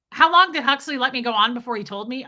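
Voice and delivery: ordinary talk, nothing marked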